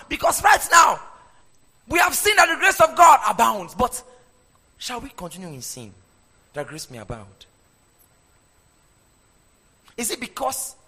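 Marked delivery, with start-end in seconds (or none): 0.1-3.7 higher and louder, proclaiming; loud proclamation. Real, not acted. Preacher proclaims over music. 4.8-7.5 much softer and lower, almost under the breath; hushed question. Acted. Preacher drops to hushed question.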